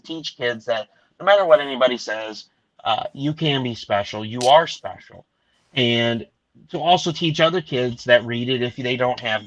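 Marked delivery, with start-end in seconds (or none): 4.2-5.2 "are" pushed louder and slowed, falling; stress on ARE. Real, not acted. To kids who get bullied, firm stress on "are". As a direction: lean hard on "are" in "you are special". Telling kids they matter.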